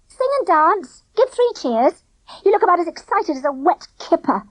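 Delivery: high, mocking sarcasm; sarcastic. A narrator's reading. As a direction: needle his gloom. Girl sarcastic at gloomy boy.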